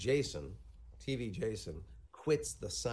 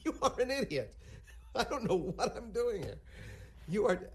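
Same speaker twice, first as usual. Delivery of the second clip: Larry's whine; 11 semitones higher than usual. Retold or imitated. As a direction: whine like a frustrated man. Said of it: "looking at him going".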